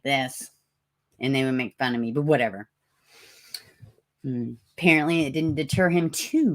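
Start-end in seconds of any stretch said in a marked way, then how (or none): none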